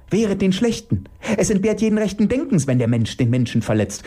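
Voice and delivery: high-pitched, horrified protest; appalled. Acted. Elke deploring people hurting people, appalled. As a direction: protest in shocked indignation, high. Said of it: "stellt Elke entsetzt fest".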